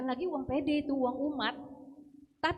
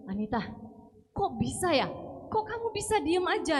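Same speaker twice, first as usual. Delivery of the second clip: amazed friend; 6 semitones higher than usual. Retold or imitated. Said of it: "Dia bilang".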